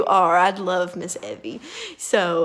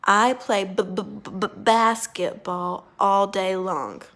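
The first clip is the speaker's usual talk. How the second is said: stutter on purpose. Shown as a demonstration. Therapist faking a stutter for the student to copy. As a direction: fake a stutter on "basketball". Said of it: "I'm gonna say it again".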